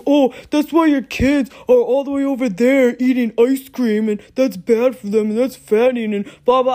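hammed-up impression of a scolding partner; mocking boyfriend. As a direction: lecture her like a preachy know-it-all, exaggerated. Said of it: "He'll be like".

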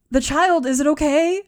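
high, loud, worried questioning; alarmed plea for news; anxious captive woman. Acted. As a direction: ask urgently, fearing the answer. Captive urgently asks after the child.

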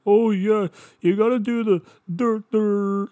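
high, loud mock know-it-all. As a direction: put on a loud, high-pitched mocking voice. Mocking imagined know-it-all advice.

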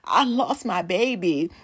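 mock distressed cry, rising high; retold sobbing. Retelling her meltdown to a mall guard, mock sobbing. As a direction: imitate a tearful meltdown, stretching the words. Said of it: "I'm sobbing and I'm crying".

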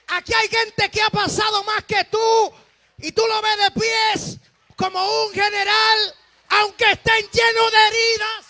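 high, loud, slow shouted praise call; preaching at full force. Acted. Preacher urging the congregation to praise. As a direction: raise the church to praise.

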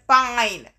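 loud, falling, exasperated shout. A narrator's reading. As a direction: fling the word up in frustration. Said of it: "Chiron shouted".

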